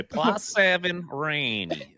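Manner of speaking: slow, high mock-Russian goalie; imitated catchphrase. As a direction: draw it out high, imitating his funny way. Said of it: "the way that he said it".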